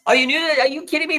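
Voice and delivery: ordinary talk, nothing marked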